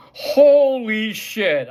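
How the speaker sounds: slow, drawn-out amazed exclamation; stunned disbelief. Real, not acted. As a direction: let it out in slow awe. Disbelief at the brawl.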